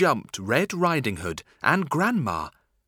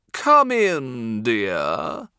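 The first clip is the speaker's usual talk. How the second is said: a purring wolf, louder, sweet-talking; 6 dB louder, much slower than usual. Acted. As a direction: purr it like a sly wolf. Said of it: "purred the Big Bad Wolf".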